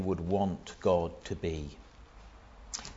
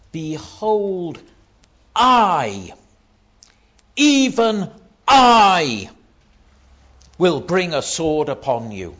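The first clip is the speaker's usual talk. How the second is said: loud, drawn-out "I" and "Even I"; God's declaration with heavy weight on "I"; emphatic; 8 semitones higher, 13 dB louder, slower than usual. Retold or imitated. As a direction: punch "I" high and loud, pausing around it. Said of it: "It's very emphatic".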